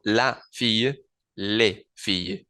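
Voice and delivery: la fille then les filles, slow and clear; les with Spanish E, S silent; la versus les demo. Shown as a demonstration. Teacher contrasting feminine singular and plural. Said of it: "en el caso femenino es más fácil diferenciarlo".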